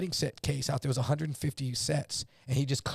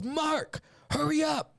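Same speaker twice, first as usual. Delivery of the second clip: urgent call; 11 semitones higher, slower than usual. Retold or imitated. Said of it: "He goes".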